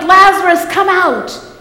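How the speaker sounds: calling the name out loud and commanding; loud cry. Retold or imitated. Preacher voicing Jesus calling Lazarus from tomb, loud command. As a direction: call him out of the tomb. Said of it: "in a loud voice he cried".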